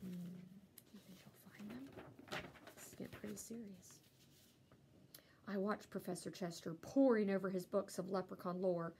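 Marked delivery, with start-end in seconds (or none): 0.0-4.0 whispered question. Real, not acted. Whispering to listeners.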